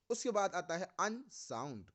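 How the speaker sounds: usual speaking voice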